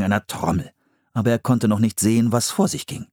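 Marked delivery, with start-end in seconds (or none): none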